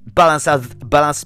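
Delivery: usual speaking voice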